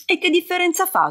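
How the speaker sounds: high, impatient snap of a question; sharp outburst. A narrator's reading. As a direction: burst out, fed up. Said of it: "sbottò Cristo". (sbottare irritato, acuto)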